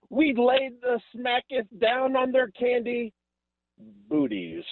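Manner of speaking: gloating taunt. Acted. Caller gloating about rival fans.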